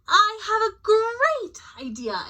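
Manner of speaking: high, slow, breathy with excitement; bursting with an idea; excited exclaim. Acted. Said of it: "exclaimed Kansas".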